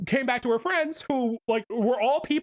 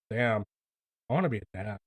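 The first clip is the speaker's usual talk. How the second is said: wistful aside; 11 semitones lower, 4 dB quieter, faster than usual. Retold or imitated. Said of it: "I'm just like".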